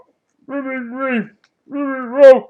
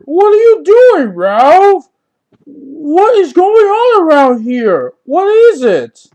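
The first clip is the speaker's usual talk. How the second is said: startled; 6 semitones higher than usual. Acted. Startled by a friend.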